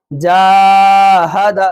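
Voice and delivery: long held "जा", then quick short ha-da in "जाहद"; quick zabar endings. Shown as a demonstration. Teacher showing quick zabar, long then short. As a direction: stretch only the first syllable of "जाहद". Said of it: "ज़बर को जल्दी पढ़ रहा हूँ".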